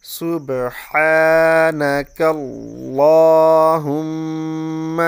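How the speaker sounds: سبحانک اللہم chanted very slowly and loudly, the ha of سبحان clear and the lam of اللہم full and heavy, held long; correct recitation. Shown as a demonstration. Teacher chanting سبحانک اللہم so students learn it. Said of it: "صحیح طریقے سے".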